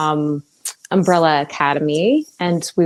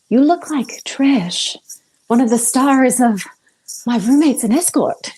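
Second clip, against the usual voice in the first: higher, slow South African accent impression; 7 semitones higher than usual. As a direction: imitate a friend's South African accent, a bit clumsy. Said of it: "I'm bad at accents".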